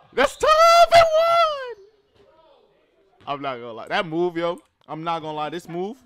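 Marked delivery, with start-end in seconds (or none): 0.1-2.3 hype scream. Real, not acted. Hype reaction to move.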